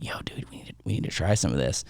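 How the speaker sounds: hushed conspiratorial whisper. Retold or imitated. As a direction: whisper it like a secret plan. Friend's secret suggestion retold.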